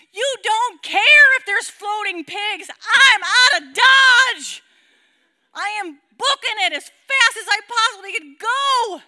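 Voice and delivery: high loud panicked wail, slow; shouting in mock panic; panicked cry. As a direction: cry out like you're fleeing in terror. Running from the scene.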